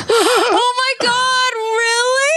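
very high, drawn-out mock squeal of delight; starstruck squeal. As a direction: squeal it as if overwhelmed by flattery. Reenacts delight at being recognized.

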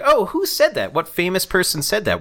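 high-pitched mock curiosity; exaggerated nosy wonder; imagined curious onlooker. Acting out an onlooker reading the wounds.

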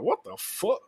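high, stunned disbelief; mock-shocked what the fuck; incredulous. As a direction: sound floored by what she said. Retelling his shock at her answer.

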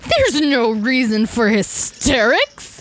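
slow, drawn-out mock scolding; Mister Collins. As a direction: imitate a stuffy official in a British comedy. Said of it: "that Mister Collins character, he's like".

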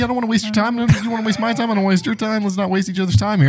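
high-pitched, insistent salesman patter; pushy sales rep. Pretend. Sales rep refusing to let customer go, high-pitched wheedling. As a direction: keep pushing in a high voice so he can't hang up. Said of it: "perfect impression of exactly what these people are".

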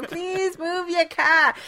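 put-on high, slow Boston accent. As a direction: drop the r in car, Boston style. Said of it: "my Boston accent".

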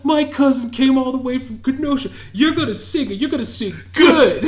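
high, loud mock menace; imitating movie threat. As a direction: bark the movie line as a comic order. Acting out movie character's threat.

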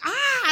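high excited squeal at a celebrity; starstruck shriek. Retold or imitated. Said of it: "and I was like".

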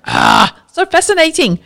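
high, thrilled exclamation; excited. Real, not acted. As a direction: gush with delight. Excited reaction after the example.

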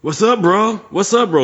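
high-pitched drunk bro imitation; drunk guy. Drunk guy accosting a stranger at a show, mock drunk bro. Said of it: "next to the drunk guy".

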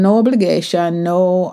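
usual speaking voice

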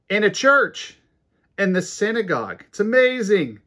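loud, high exclamations in short bursts; real amazement. Real, not acted. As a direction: punch each phrase with preacher's astonishment. Preacher exclaims over the miracle.

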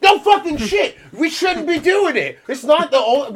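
high, loud outraged objection; exasperated mock protest. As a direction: protest as if it were painfully obvious. Voices every other physician's outraged objection.

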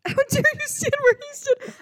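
high, loud theatrical indignation; mock outrage. Fan confronting an impostor at a meet and greet, hammed-up outrage. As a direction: confront the impostor in an over-the-top squawk. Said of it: "I'd be like".

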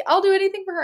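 high, loud, soothing offer; gushing willingness; retold eager promise. Retold eager promise to child self.

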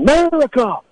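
high-pitched mock yelling of America. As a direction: call it out high like a passing heckler. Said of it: "they start yelling".

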